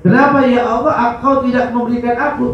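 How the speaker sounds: high, fast protest to God; demanding complaint. Acted. Believer demanding why God withholds, complaining. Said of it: "Jangan ditanya".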